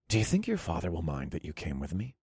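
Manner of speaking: low, soft murmur; soft question. A narrator's reading. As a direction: ask it gently into her ear. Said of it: "I questioned softly".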